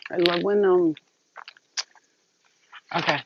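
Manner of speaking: normal talking voice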